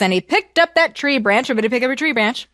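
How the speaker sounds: usual speaking voice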